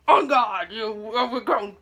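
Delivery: hammed-up exasperated insult, loud at the start; slow down on a mock exasperated jab; mock bickering. Reviewer imitating Lumiere and Cogsworth squabbling, mock exasperation.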